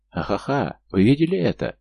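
laughing, then a high delighted shout; gleeful yell. A narrator's reading. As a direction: whoop with glee at the fall. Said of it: "радостно завопил Илья".

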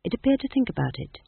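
ordinary delivery, nothing marked

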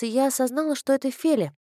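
usual speaking voice

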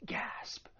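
whispered stage gasp; mock-shocked interjection. Shown as a demonstration. Teacher reacting to a surprising example, whispered mock shock. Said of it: "people may use this as an interjection".

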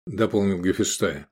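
ordinary delivery, nothing marked

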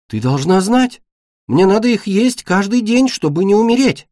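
high, urgent pleading; upset insistence. Acted. Беда insists to her mother. (взволнованно настаивая, высоким голосом)

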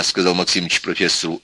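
usual speaking voice

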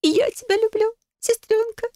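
very high, emotional sigh; tearful exhale. A narrator's reading. Sister answering Adele's love, tearfully. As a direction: breathe it out, still weepy and high. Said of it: "выдохнула Фелиция".

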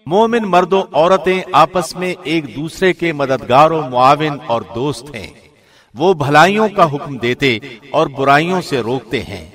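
ordinary talk

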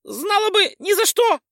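very high loud pixie squeal. Acted. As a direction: wail it in a tiny voice. Pixie in agony. (визг пикси)

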